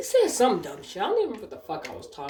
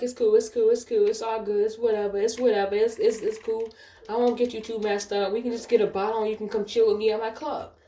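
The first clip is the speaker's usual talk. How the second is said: higher-pitched, insistent mock reassurance; imitating persistent suitor; 5 semitones higher than usual. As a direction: play the rejected customer shrugging it off. Retold suitor brushing off rejection.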